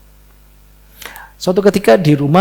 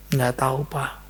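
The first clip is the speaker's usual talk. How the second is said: put-on quiet child answer; 8 dB quieter, slower than usual. Anak menangis menjawab ayah.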